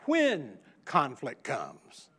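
when slowed and stressed. Shown as a demonstration. Preacher correcting if to when for the congregation, stressing when. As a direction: lean on "when", drawn out slow. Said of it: "I said".

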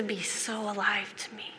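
soft whisper; whispered regret. Real, not acted. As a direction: breathe it out with longing. Soft confession.

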